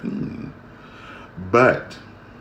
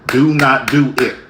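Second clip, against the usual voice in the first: louder, spelled-out warning, every word separate; loud final warning; 6 semitones higher, 8 dB louder than usual. Retold or imitated. Fed-up speaker warning people who won't stop, loud. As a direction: slam down the last warning. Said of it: "And I always say".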